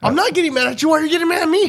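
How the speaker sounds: high-pitched squawking protest; mock defensive outburst. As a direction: protest in a hammed-up, high indignant voice. Acted quarrel, mock defensive outburst.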